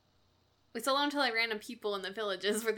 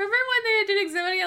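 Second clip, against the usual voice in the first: excited; 11 semitones higher, 5 dB louder, faster than usual. Real, not acted. Excited recall, fast and high.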